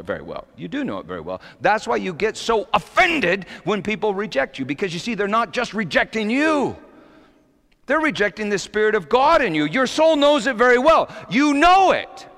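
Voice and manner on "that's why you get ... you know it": high, emphatic pulpit delivery; raised, fervent sermon voice; impassioned preaching. Real, not acted. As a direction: preach it out, stretching the big phrases. Preaches to congregation.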